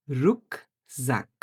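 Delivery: রুকজাক drawn out slowly, syllable by syllable, ending with a clear k; teacher slowly modelling a German word for students; slow model word. Shown as a demonstration. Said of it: "এটার উচ্চারণ হচ্ছে ক এর মতো".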